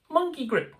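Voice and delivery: high monkey voice, lightly done; mock ape voice; monkey impersonation. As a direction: say it like a playful monkey. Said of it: "That's my monkey impersonation".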